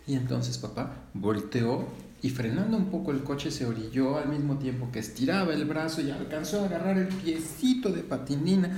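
plain reading voice

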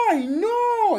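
loud, high-pitched wail of dismay; very high alarmed exclamation; frightened mother. Acted. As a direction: cry out in motherly panic. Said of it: "la señora se asustó mucho".

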